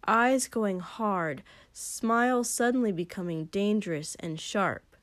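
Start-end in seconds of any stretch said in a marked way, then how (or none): none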